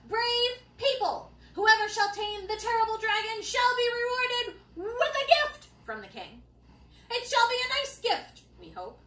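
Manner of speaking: lightly pompous decree with unsure asides; a hint of a herald losing confidence; hesitant proclamation.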